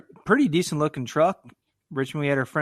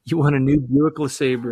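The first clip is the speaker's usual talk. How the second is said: booming prize announcer; 5 dB louder than usual. Pretend. Mock game show prize reveal.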